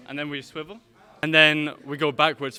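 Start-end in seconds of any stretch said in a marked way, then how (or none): 1.2-2.6 loud instructor call over music; calling out loudly. Shown as a demonstration. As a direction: project it across the dance floor. Instructor calls steps to dancers.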